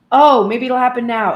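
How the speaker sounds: loud, hopeful burst; hopeful outburst. Retold or imitated. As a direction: blurt it out full of hope. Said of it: "like I just said".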